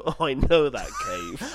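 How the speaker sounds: slow, knowing Welsh local; Welsh accent. Retold or imitated. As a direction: drawl it like a proud Welshman. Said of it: "another Welshman would go".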